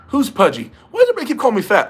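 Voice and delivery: high, loud mock-offended protest; mock outrage. Streamer to teasing chat, loud mock-offended protest. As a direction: play up being insulted by the nickname.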